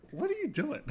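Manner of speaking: high, laughing "what are you doing"; laughing disbelief. Real, not acted. To a friend about a reality show, laughing disbelief. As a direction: laugh through the question in disbelief. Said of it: "laughing disbelief".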